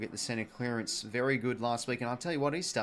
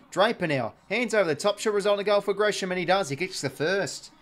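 rising, louder call as the goal comes; excited goal call; 7 semitones higher, 6 dB louder than usual. Real, not acted. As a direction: call it home like live play-by-play, up high.

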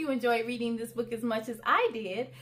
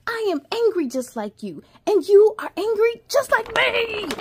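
building to a louder pretend anger; playful angry chant; mock angry; 6 semitones higher, 4 dB louder than usual. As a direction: stomp the words out like a cross child.

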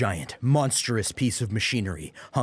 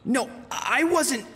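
high, slow flustered protest; flustered denial; slower than usual. Acted. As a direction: deny it, embarrassed and drawn out. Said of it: "Jesse objected".

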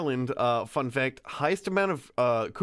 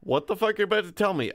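fast, laughing what-the; real laughing outburst; laughing disbelief; 6 semitones higher, much faster than usual. Real, not acted. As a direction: blurt it out through laughter. Laughing at shocking setup.

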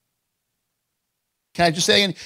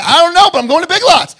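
much louder and higher, hammed-up outburst; mock frantic insistence; 13 semitones higher, 13 dB louder than usual. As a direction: act out an exasperated, baffled yell. Acted exchange, baffled wife insists.

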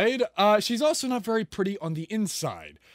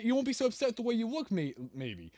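ordinary talk

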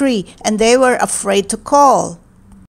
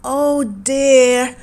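mild cry of dismay; a touch of dismay, voiced for a character. A narrator's reading. Boy hears the babies are gone, mildly crying out. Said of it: "he cried".

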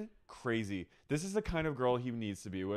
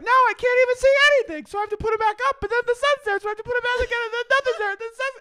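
high, loud, fast squealing ramble; squealy impression; 9 dB louder, much faster than usual. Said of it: "you're like".

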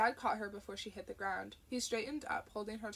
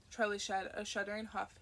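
usual speaking voice

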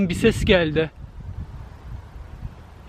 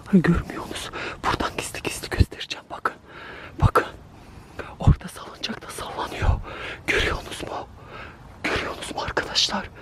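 tense breathy whisper while hiding; scared whisper; 8 dB quieter, faster than usual. Real, not acted. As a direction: whisper so it won't hear you. Hiding, secretly showing the swing. (saklanırken korkulu fısıltı)

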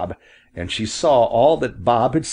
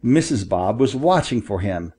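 usual speaking voice

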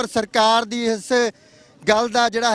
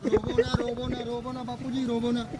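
comforting; 10 dB quieter than usual. Real, not acted. Reporter soothing a crying old man.

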